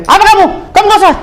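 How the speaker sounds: high, snapping mock judge. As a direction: sneer at the lawyer like a rude judge. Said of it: "Hakim juga kalau ngomong". (menirukan hakim membentak dengan nada tinggi)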